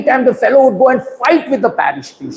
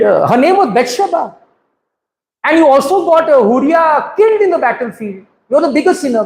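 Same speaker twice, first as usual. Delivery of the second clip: mock angry accusation, pitched higher; angry retort; 6 semitones higher, faster than usual. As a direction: accuse David in a raised, indignant voice. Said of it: "And this fellow got angry".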